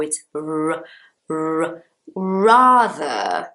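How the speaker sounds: lone held "r" sounds, then "rather"; English initial R. Shown as a demonstration. Teacher drilling the first sound, English R into "rather". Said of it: "at the beginning of the word in English sounds like r".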